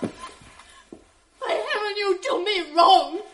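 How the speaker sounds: slow, pleading, breaking cry; tearful protest. Acted. Wife protests innocence to husband, tearful. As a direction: protest through tears. Desdemona protests, near tears.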